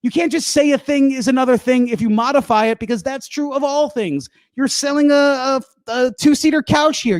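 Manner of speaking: high-pitched indignant comic tirade; hammed-up outrage at a shop assistant; mean rant. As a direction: tell them off, worked up and high. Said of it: "I got mean at the end".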